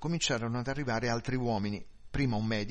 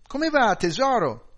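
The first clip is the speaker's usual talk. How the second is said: high, loud, smiling greeting; cheery calling out; 11 semitones higher, 11 dB louder than usual. A narrator's reading. Wife greets husband on coming home, loud and cheery. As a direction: call it through the house, all smiles. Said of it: "canticchiava un motivetto ed era tutta sorridente".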